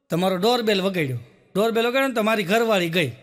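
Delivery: ordinary talk, nothing marked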